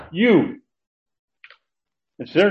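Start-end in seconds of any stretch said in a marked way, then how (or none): 0.1-0.6 "You." punched high and loud; emphatic you. Shown as a demonstration. Teacher showing the emphatic pronoun, punched "You." high and loud. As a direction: hit "You." like pounding the pulpit. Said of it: "this is emphatic".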